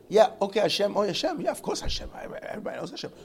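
high, loud, hurried-sounding concession; dismissive acknowledgment. Acted. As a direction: brush it aside as too obvious to mention. Acts perfunctory nod to God.

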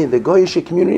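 usual speaking voice

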